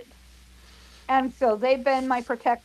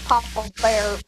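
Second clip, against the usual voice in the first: ordinary talk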